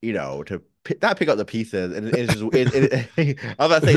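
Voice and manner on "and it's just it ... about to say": laughing. Real, not acted. Laughing mid-sentence.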